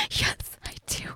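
quick hushed whisper. Acted. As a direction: whisper it fast and low. Aroused roleplay aside.

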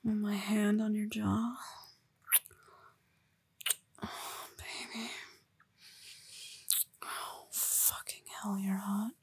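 ordinary delivery

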